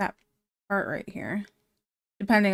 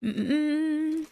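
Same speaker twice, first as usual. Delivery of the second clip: ordinary talk, nothing marked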